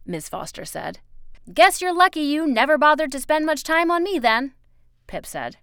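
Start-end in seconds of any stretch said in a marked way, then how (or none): 1.5-4.5 put-on loud, high child character voice; sarcastic, high and loud; Pip, bitter retort. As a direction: fling it back at an adult, voice raised.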